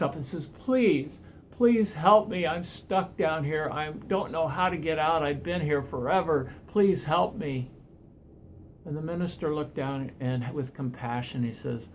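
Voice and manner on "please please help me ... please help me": higher, pleading call up to a passerby, slowing at the end; desperate man calling up from the hole. Acted. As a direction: beg him earnestly, drawing out the last please. Begs the minister for help.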